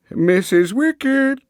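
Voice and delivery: high, slow sing-out of the name; old schoolmaster singing out a name; jerky high-pitched old man. A narrator's reading. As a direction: call out to the landlady in a high old voice. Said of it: "Chips would sing out in that jerky, high-pitched voice".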